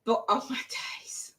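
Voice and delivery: breathless whisper of amazement; whispered awe. Real, not acted. Telling viewers how the film ended, awed whisper. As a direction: whisper it, still overwhelmed. Overwhelmed recalling the film's ending.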